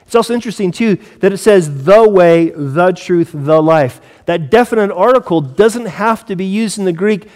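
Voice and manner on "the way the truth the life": "The" hit loud and full before "way", "truth" and "life"; stress on THE. Shown as a demonstration. Preacher pointing out the Greek definite article, emphatic. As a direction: punch each "the" as the key word. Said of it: "again, it's for emphasis".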